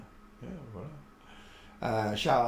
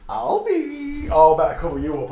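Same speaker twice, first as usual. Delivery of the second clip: fond exclamation; 8 semitones higher, 11 dB louder than usual. Real, not acted. Fussing over the dog.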